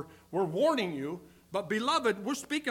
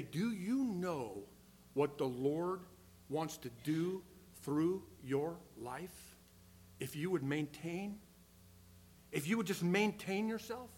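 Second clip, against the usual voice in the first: soft, low, unhurried appeal; gentle appeal; 3 semitones lower, 5 dB quieter than usual. Acted. Preacher appealing to young people about their lives, gently. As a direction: speak slowly and tenderly, leaving space between phrases.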